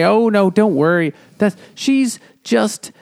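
high, stretched-out mock reassurance; flustered cover-up. Willie covering up the affair to his son, flustered. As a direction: pitch way up, stalling for an excuse. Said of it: "Willie is trying to say".

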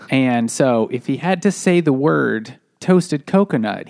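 normal talking voice

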